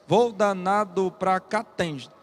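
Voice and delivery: slow, evenly separated syllables; syllable-by-syllable scansion. Shown as a demonstration. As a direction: count out the verse beat by beat. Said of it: "quantas sílabas métricas em cada verso".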